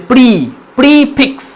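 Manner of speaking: long held ee in "প্রি"; RP long vowel. Shown as a demonstration. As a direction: draw out the ee in "প্রি", then "প্রিফিক্স". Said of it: "long ই হবে".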